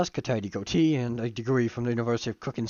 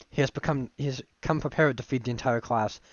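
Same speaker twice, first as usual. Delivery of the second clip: ordinary delivery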